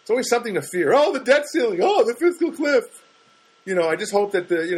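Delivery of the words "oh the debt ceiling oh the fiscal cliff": high, loud mock alarm; mock panic. Comic mocking the panic over the fiscal cliff with hammed-up alarm. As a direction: gasp in fake dread at the debt ceiling. Mocking fearful news talk.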